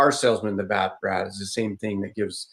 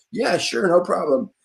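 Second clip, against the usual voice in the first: eager helper; 9 semitones higher than usual. Retold or imitated. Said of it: "Can you come look?".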